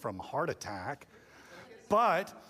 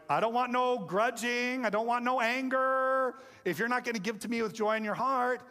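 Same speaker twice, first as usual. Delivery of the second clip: higher-pitched, hammed-up insistence; mock indignant parent; 8 semitones higher than usual. Parent rejects a grudgingly given fry, mock indignant and high. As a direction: refuse it like an affronted parent, pitched up.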